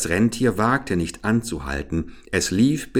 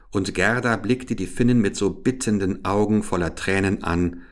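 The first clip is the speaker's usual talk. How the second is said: ordinary delivery, nothing marked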